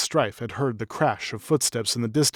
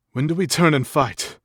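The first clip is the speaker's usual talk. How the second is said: panting; 4 semitones higher, 5 dB louder than usual. Acted. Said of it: "panting with effort".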